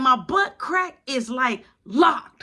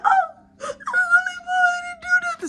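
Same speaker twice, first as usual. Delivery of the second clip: very high, wailing mock sob; hammed-up bawling child's whine; mock crying. Son protesting to mom after being hit, mock crying wail. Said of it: "I'm crying".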